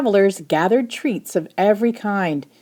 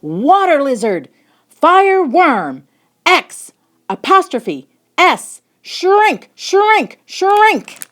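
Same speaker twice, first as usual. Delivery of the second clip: a loud, much higher spell cast at a monster; commanding shout; 11 semitones higher, 6 dB louder, much slower than usual. Acted. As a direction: cast the shrinking spell with all your might. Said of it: "he shouted".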